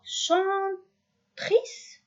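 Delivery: "chantrice" high and drawn out, as a question; doubtful, high rising "chantrice"; questioning wrong form. Shown as a demonstration. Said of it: "Non, on peut pas dire ça".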